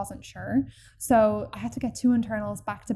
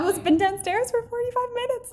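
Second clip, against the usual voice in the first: exasperated; 13 semitones higher, 3 dB louder than usual. Real, not acted. Exasperated at the midwife.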